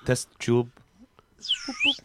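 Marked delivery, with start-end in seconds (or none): 1.4-2.0 high squeaky beeping noises; mock machine beeps. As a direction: beep like a lab machine. Machine beeps in lab scene.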